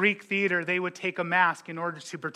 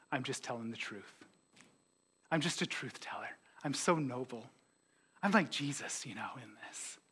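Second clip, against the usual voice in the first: hushed, low self-righteous murmur; mock humility; 5 semitones lower, 10 dB quieter than usual. Churchgoer justifying slander, mock humble and quiet. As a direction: play the noble truth teller, softly and low.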